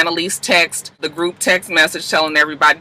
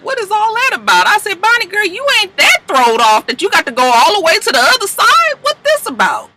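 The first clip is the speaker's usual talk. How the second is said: incredulous; 14 semitones higher than usual. Real, not acted. Questioning Bonnie's move.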